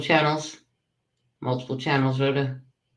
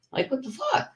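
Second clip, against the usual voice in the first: incredulous squeal; 6 dB quieter than usual. Real, not acted. Outraged rant about a rival streamer.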